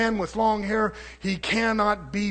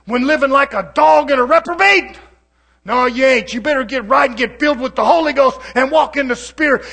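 loud, higher, pounding sermon voice; fiery preaching; 6 semitones higher, 11 dB louder than usual. Real, not acted. Preacher condemning worldly believers, loud and fervent. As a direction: preach it hard and hot.

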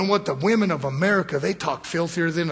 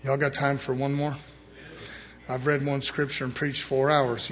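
usual speaking voice

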